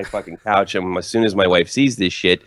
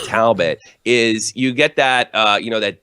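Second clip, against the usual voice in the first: ordinary talk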